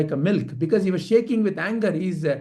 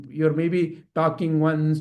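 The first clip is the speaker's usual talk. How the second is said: ordinary delivery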